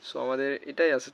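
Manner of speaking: ordinary talk, nothing marked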